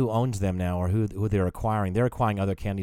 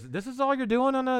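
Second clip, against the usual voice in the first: clerk voiced much higher, lightly mocking; imitating the clerk; 14 semitones higher than usual. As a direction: pitch way up for her incredulous question. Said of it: "She goes".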